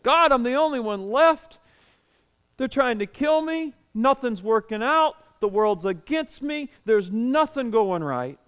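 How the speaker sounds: high-pitched whining complaint; whiny Elijah. Retold or imitated. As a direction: whine at God like a sulking child. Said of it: "he's almost whiny".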